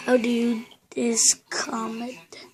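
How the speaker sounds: soft unvoiced whisper. Real, not acted. Gamer whispering a question to the game, slow. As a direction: breathe the question without voice.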